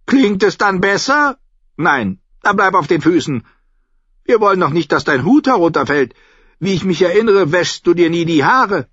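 put-on high, loud, imperious old woman; bossy old-lady voice, pitched high; sharp-tongued old lady. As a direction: chide the fool like a tart matriarch. Old lady chides the fool.

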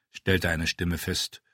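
plain reading voice

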